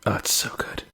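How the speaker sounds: unvoiced whispered repeat. Real, not acted. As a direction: breathe it out softly. Whispered repeat while savoring.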